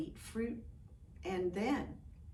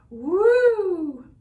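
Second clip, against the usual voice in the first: much higher, loud hooting woo; mock stomach woo; 10 semitones higher, 16 dB louder than usual. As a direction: ham up a queasy stomach noise. Chief's stomach noise.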